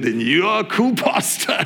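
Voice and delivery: ordinary talk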